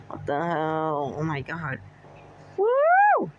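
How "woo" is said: high, loud whoop of joy; excited whoop. Real, not acted. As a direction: cheer it out like on a ride. Cheering on a ride.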